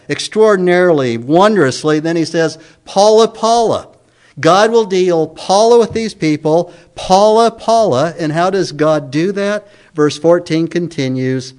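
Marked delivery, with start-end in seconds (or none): 2.8-3.9 "Paula, Paula" pitched high, each one punched; emphatic repetition. Shown as a demonstration. Preacher quoting God's repeated Hebrew word, emphatic "Paula, Paula". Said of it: "three times for emphasis". 6.9-8.1 "Paula, Paula" high, the first strongest; emphatic repetition. Shown as a demonstration. Preacher repeating the Hebrew word for emphasis, emphatic "Paula, Paula". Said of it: "three times for emphasis".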